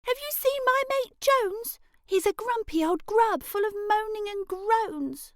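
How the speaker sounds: put-on girl's voice. Elray questions the butterfly.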